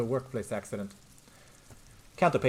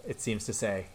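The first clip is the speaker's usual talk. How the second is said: ordinary talk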